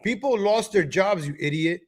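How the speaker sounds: ordinary talk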